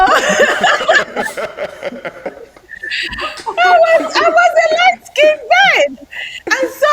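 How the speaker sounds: high-pitched laughter bursting through the words; can barely get the words out for laughing; laughing hard. Real, not acted. Guest laughing at her younger self, loud and high.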